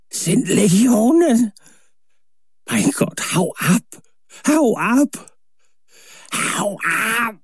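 high, slowed shrieks of terror; terrified scream. A narrator's reading. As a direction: scream the warning in horror. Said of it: "zu einem Schrei an".

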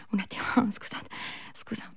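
whispered through laughter; giggling whisper; laughing apology. Real, not acted. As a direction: apologise while breaking up laughing. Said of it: "non trascriverlo questo".

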